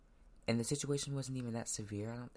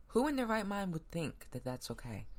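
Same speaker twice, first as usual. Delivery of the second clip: high, raised disbelieving demand; real outrage at the choice; indignant outrage; 7 semitones higher than usual. Real, not acted. Indignant rhetorical question.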